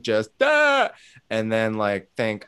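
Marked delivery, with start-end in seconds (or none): 0.4-0.9 sudden high, loud imitated sob cry; a burst of mock breakdown wail; mock dry sob. Imitating a stranger sobbing in a grocery store, high mock wail. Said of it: "like, dry sobbing".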